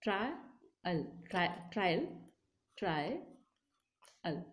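"Try, al" said as two separate syllables; sounding out words. Shown as a demonstration. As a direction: split "trial" into "try" and "al" with pauses. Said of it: "like this".

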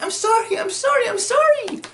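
very high, panicky pleading act; mock frantic apology. Apologizing after the force destroys things, mock panicky squeal. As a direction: squeal it over and over, hammed-up.

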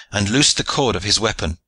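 usual speaking voice

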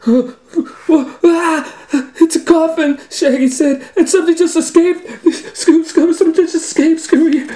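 high, loud, fast panicky squeal; high frantic Shaggy, half laughing. Acted. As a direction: shriek it out in a scared rush.

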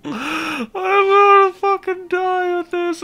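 shrieking high through laughter, loud; cracking up and yelling the words; real laughing fit, high and loud; hysterical laughter. Real, not acted. Losing it over opponent's pick.

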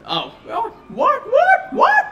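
ordinary talk, nothing marked

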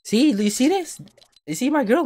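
mock outrage. Real, not acted. Streamer calling out his girlfriend to viewers, mock outraged.

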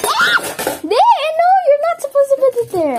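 high, loud no no; alarmed shriek. Real, not acted. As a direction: cry out to stop her. Stopping sibling, high shriek.

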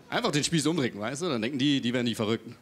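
ordinary talk, nothing marked

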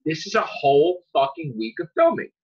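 ordinary delivery

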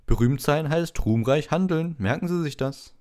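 preen over your fan mail; Lockhart voice. A narrator's reading. Lockhart boasts about a fan to Harry, high and pompous.

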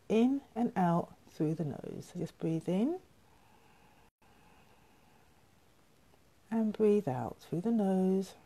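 soft, unhurried breathing cues with long pauses; guided meditation. Real, not acted. Teacher guiding viewers through three gentle breaths, soft meditation voice. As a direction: hush the voice and let each instruction settle.

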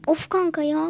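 a loud exasperated groan of "Of kanka ya"; fed up with her friend; annoyed outburst. Acted. Girl exasperated at her friend's gossip, loud annoyed outburst.

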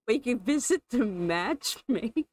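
laughing through the words; can't keep a straight face. Real, not acted. Player suggesting the matchmaker again, laughing as she says it. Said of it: "I can't even say it with a straight face".

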